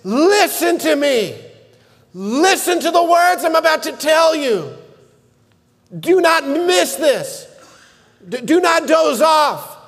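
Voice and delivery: grab them by the collar with it; urgent call to attention. Acted.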